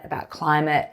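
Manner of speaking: usual speaking voice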